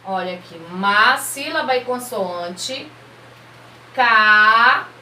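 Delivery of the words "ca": "Ca" held long and loud; isolated syllable. Shown as a demonstration. Teacher separating macarrão for children, held middle syllable. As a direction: stretch "Ca" out on its own. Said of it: "eu vou separar".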